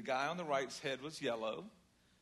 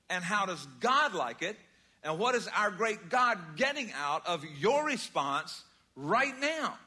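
louder, rising preacher's push on every phrase; full-force preaching; 5 semitones higher, 7 dB louder than usual. Acted. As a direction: drive the point home to the congregation. Preaching the main point.

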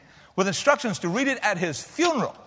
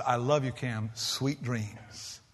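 soft goodnight; 6 semitones lower, 8 dB quieter, much slower than usual. Retold or imitated. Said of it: "and said".

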